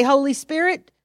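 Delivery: fervent prayer spoken aloud. Shown as a demonstration. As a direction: pray it out with force. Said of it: "So you say".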